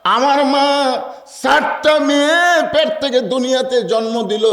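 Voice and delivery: high, drawn-out imitation of a weeping daughter; tearful plea. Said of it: "Fatema কান্দের বলে". (কান্নার অভিনয়ে উঁচু সুরে আর্তি)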